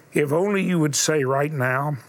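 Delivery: normal talking voice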